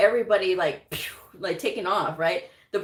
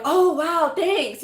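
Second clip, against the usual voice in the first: much higher and louder, gushing "Oh, wow"; mock excited thanks; 11 semitones higher, 7 dB louder, much slower than usual. Coworkers thanking her for the breakfast, in a high gushing voice. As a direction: play the coworkers' delighted surprise. Coworkers reacting to brought donuts.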